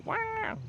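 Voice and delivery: high squealing fake cry to prompt the baby; playful hammed-up wah; mock baby wail. Shown as a demonstration. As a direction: show the baby how to scream. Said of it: "You wanna yell into the microphone? Scream".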